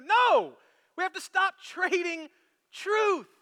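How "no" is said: high, loud cry of no; a sudden preacher's shout; sharp no. Real, not acted. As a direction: cut them off with a shouted no. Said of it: "preacher's rebuttal".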